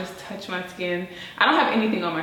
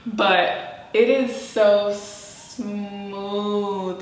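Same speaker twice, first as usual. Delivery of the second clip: very slow emphatic; much slower than usual. Real, not acted. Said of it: "very slow emphatic".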